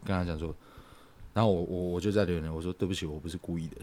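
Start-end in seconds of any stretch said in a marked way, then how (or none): 2.7-3.8 soft, quick, hushed sorry through tears; quietly tearful apology. Retold or imitated. Retelling apologizing to an angry girlfriend, soft and tearful. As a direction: mumble the apology while crying silently. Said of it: "在流眼泪".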